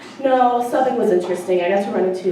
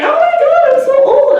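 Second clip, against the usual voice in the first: much higher and louder mock despair; dismayed squeal; 11 dB louder than usual. Math teacher realizing how old she is, in mock dismay. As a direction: wail it in comic dismay.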